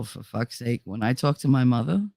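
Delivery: ordinary delivery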